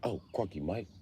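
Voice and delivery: soft, hushed British warning; a nervous Brit murmuring to his mate; quiet mock British accent. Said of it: "the other British guy was like".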